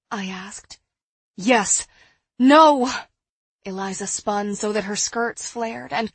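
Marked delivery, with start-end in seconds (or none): 1.3-2.9 loud answer jumping to a higher cry; frantic. A narrator's reading. Woman torn over boiling water, frantic and loud. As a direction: blurt it, then cry out the reversal.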